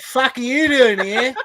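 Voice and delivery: slow, high spider challenge through laughter; hammed-up confrontational spider; mock spider voice. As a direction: demand it as an affronted spider.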